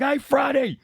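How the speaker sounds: high, loud obnoxious shout; heckling fan. Acted. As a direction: yell abuse from the stands. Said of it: "first one to be like".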